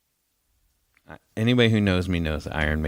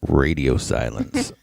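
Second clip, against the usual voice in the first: 1 deadpan bird voice; 7 semitones lower, slower than usual. Retold or imitated. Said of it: "He was like".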